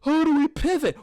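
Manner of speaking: lightly mocking imitation, pitched high; Melissa Ford. Podcaster mimicking a co-host who changes the subject, mocking. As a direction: mimic her question in a high voice. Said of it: "Melissa Ford is like".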